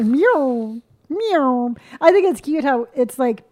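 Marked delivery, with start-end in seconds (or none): 0.0-1.7 "Meow" standing in for mirror, squashed into one syllable; mispronounced word. Shown as a demonstration. Showing the family's word they cannot say, mispronounced. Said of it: "a word that we cannot say".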